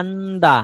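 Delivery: "আন্দা" ending in a long open "aa"; silent final R. Shown as a demonstration. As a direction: teach "আন্দা" as AN-daa, no R at the end. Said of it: "নিচের উচ্চারণ হবে".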